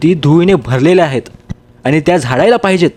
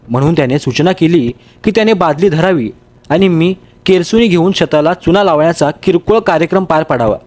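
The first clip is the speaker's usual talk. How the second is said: ordinary delivery, nothing marked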